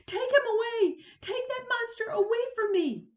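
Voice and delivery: high, loud terrified shriek. Acted. Frightened neighbor shrieking at the crocodile. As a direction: scream for the monster to be taken away. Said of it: "began to shriek".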